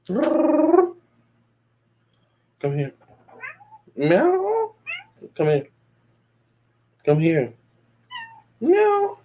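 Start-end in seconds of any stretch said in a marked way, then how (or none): 0.1-1.0 very high sing-song call; calling the cat. Real, not acted. 4.0-4.7 high squeaky meow imitation to call the cat. Calling the cat. 8.6-9.2 high squeaky meow imitation to call the cat. Calling the cat.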